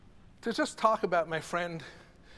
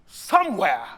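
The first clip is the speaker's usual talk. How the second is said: preacher cry; 2 semitones higher, 13 dB louder than usual. Shown as a demonstration. Preacher voice tag line.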